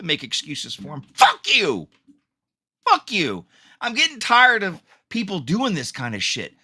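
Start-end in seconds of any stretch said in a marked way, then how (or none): 1.1-3.4 real furious yell, pitched up and cracking; real angry outburst. Real, not acted. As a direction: spit it out at the people making excuses. Furious at excuse makers.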